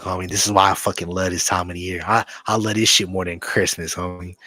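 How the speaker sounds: usual speaking voice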